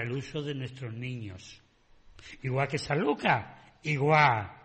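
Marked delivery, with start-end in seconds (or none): 2.4-4.5 loud sarcastic dig at the town; ironic jab. Real, not acted. Radio caller needling Sanlúcar politicians, loud and ironic. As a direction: say it as a pointed hint. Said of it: "La indirecta ya la estáis cogiendo".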